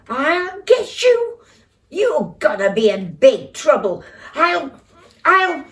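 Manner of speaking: put-on furious little boy shouting; angry shout. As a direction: yell it down at your brother. Peter shouts up the tree.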